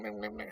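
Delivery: high sung ringtone noises; chirpy mock phone ring; phone ring imitation. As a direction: mimic a phone ringing.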